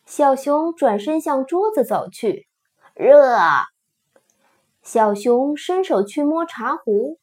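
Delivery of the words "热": loud call. A narrator's reading. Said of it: "弗洛格叫道".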